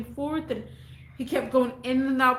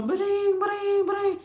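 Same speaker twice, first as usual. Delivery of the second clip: imitated ringtone; 9 semitones higher than usual. Mimicking a phone ringing.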